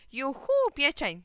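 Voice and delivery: high, loud, drawn-out whoop; gleeful cry. A narrator's reading. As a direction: cheer like a delighted boy. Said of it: "zawołałem". (radosny, przeciągły okrzyk)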